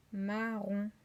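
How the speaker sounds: "Marron" split as ma, ron; slow, even syllables in "Marron". Shown as a demonstration. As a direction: stretch "Marron" into two held beats. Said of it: "première syllabe".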